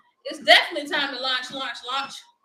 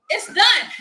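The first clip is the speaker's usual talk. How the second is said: it's done, higher and louder with pride; triumphant exclaim; 5 semitones higher, 7 dB louder than usual. Real, not acted. Host celebrating her finished classes, triumphant exclaim. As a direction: shout the finish like a victory.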